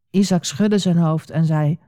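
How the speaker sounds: plain reading voice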